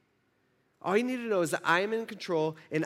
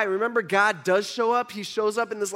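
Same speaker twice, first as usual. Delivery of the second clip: ordinary talk, nothing marked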